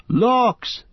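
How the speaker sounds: high, startled yelp; Merry seeing the swimming floor, startled cry; surprised exclamation. A narrator's reading. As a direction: gasp at the flooded floor. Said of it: "said Merry".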